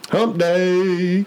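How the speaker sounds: excited shout. Acted. Echoing hump day loudly.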